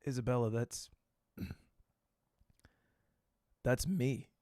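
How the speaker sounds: low, quiet, halting admission; sheepish confession. Retold or imitated. Comedian owning up to actress, low and sheepish. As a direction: confess it softly with long pauses.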